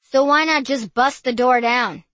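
loud brash tourist; bawling American. Acted. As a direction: yell it out, brash. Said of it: "distinctly American voice bawled out".

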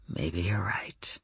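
hushed low whisper, slow; soft midnight voice. A narrator's reading. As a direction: breathe it softly into the phone. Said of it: "he said in a soft midnight voice".

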